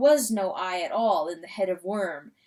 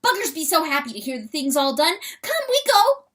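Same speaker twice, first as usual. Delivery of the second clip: very high, loud, wondering little weasel; excited weasel voice; 8 semitones higher, 6 dB louder, faster than usual. Acted. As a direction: squeal it out in awe as the small weasel. Weasel urges rooster.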